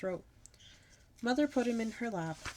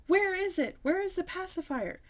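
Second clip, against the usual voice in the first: frantic; 11 semitones higher, 4 dB louder than usual. A narrator's reading. Frazzled mother searching.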